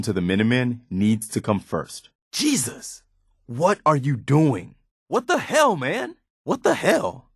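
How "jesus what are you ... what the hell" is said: high, strained outburst of disbelief; shocked disgust. Acted. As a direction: recoil in horror at what you just saw. Companion reacts to shocking act.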